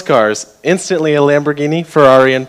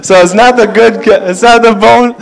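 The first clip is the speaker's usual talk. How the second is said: laughing while talking; 6 semitones higher, 4 dB louder than usual. Real, not acted. Explaining while still laughing.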